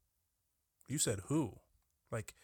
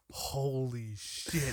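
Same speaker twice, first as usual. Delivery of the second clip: slow, drawn-out shocked gasp of words; shocked coworker; much slower than usual. Retold or imitated. Coworker stunned by his Yahoo slip: slow shocked. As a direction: let the jaw drop as you say it. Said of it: "her jaw just dropped, like".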